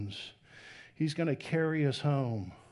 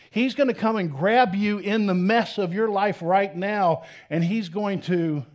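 louder, higher, building excitement; fervent preaching; 5 semitones higher, 6 dB louder than usual. Acted. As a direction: preach it with wonder at full force. Preaching God's coming at force.

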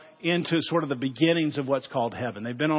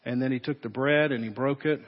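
closing prayer. Acted. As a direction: speak gently to God before communion. Pastor leads closing prayer.

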